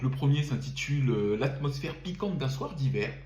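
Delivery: ordinary talk, nothing marked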